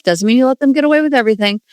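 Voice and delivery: normal talking voice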